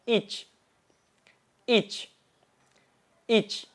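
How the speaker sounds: "ich" with a slight hiss on the final ch; desis di akhir kata. Shown as a demonstration. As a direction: say "ich", letting the ch hiss out at the end. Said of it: "harus ada desisannya nih sedikit". ("ich" dengan sedikit desisan di akhir)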